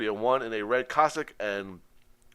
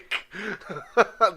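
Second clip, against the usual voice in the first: hearty laugh; 9 semitones higher, 6 dB louder than usual. Real, not acted. Laughing after joke.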